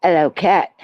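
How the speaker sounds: loud, mock Cockney greeting; playful Cockney accent with dropped h; Cockney-style accent. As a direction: call it out in a hammed-up Cockney. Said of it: "That wasn't Cockney. He's not Cockney".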